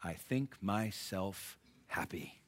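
a soft slow whisper; "I think myself happy" whispered slowly; whispered quote. Acted. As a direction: breathe the words with wonder. Paul's words whispered.

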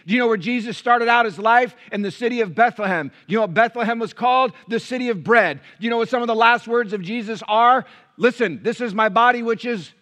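loud, high, ringing preacher's cadence; impassioned pulpit preaching; full-force preaching. Acted. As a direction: preach it out to the church with fire. Preaching the bread of life.

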